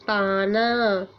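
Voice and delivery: पाना drawn out very slowly, syllable by syllable; teacher stretching पाना slowly; slow modelled word. Shown as a demonstration. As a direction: stretch पाना out for children to copy.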